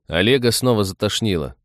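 plain reading voice

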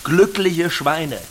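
grand, slow marketing announcement; slogan pitch. Acted. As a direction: savour the slogan you just invented.

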